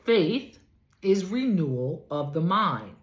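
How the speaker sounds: ordinary talk, nothing marked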